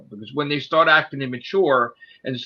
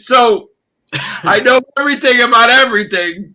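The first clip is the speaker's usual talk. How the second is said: pitched way up, loud and proud; excited boast; 9 semitones higher, 6 dB louder than usual. Real, not acted. Bragging to viewers about knowing loss prevention, excited and loud. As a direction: crow about knowing it all.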